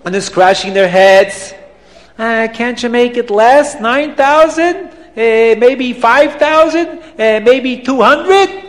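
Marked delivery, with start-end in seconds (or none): 2.2-8.6 higher-pitched pleading buyers, each rising; tentative questioning crowd voices; hesitant haggling. Acted. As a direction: haggle hopefully, voice lifting at each offer. Crowd haggles timidly over price.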